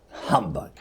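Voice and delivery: low, quiet scoffing humbug; muttered humbug. Acted. Scrooge dismisses the ghostly tiles with a soft low mutter. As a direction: grumble it under your breath, low. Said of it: "said Scrooge".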